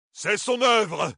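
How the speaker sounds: cri aigu et lent; accuse-le en criant; Toram s'écrie. A narrator's reading. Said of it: "s'écria Toram". (Toram accuse Altor, cri aigu et lent)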